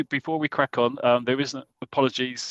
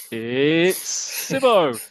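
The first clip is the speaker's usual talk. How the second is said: very slow name reveal, stretched out; slow grand announcement of the name; drawn-out announcement; 2 semitones higher, much slower than usual. Acted.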